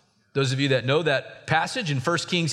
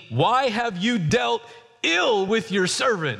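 voicing Moses; 8 semitones higher, slower than usual. Moses complains to God.

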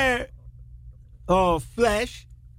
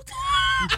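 burst of laughter. Real, not acted. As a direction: laugh out at the joke. Laughing at his own retort.

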